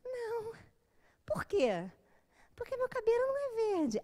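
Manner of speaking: lightly voiced woman, matter-of-fact; imitating the woman. Woman answers in retold dialogue.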